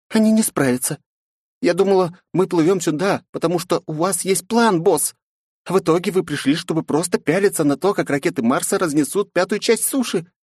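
put-on high, reproachful girl voice; indignant young woman. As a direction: scold the boss in a high voice. Narrator voices Flow.

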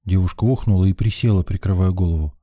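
usual speaking voice

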